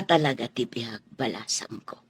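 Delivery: low, whispered comfort. Acted. Mother consoling her daughter, low whisper.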